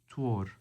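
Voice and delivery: "Twor" repeated with a t at the start; D pronounced t. Shown as a demonstration. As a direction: say "Twor" as a model, t for written D. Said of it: "spelled with a D".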